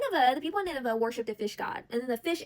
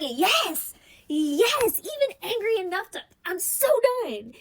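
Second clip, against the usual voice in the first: higher and louder, a hammed-up huffy meltdown; petty dramatic outburst; 8 semitones higher, 5 dB louder, slower than usual. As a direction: play the petty prophet throwing a tantrum. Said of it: "Immediate crash out".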